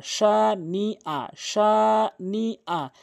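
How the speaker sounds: সা নি আ drawn out syllable by syllable; slow syllable drill. Shown as a demonstration. Teacher spelling out the word for students, very slow. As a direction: stretch each syllable slowly for learners. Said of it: "শিন জেরে শি".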